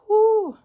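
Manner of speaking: high breathy unvoiced whoo of disbelief. Real, not acted. To herself after overspending, a high whispered whoo.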